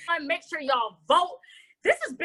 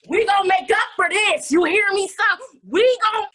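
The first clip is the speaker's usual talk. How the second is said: louder, higher emphatic promise; hammed-up vow; 4 semitones higher, 6 dB louder than usual. Host promising Jonah a make-up, loud emphatic vow. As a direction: punch it out like a fired-up vow.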